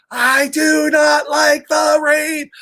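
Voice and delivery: high, slow, drawn-out yell; screaming Piggy. Acted. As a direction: scream each word out like a furious pig. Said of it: "So he starts screaming".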